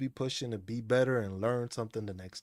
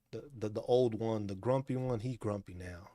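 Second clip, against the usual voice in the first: ordinary delivery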